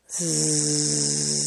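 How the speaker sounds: low soft drawn-out zzz; sleepy snore. Acted. Tejas falling asleep at bedtime, soft zzz. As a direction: drift off into sleep.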